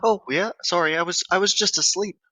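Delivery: high-pitched innocent excuse; put-on high voice, mock innocent; imitated grandmother. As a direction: play a grandma brushing it off.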